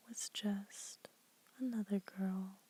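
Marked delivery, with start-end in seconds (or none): none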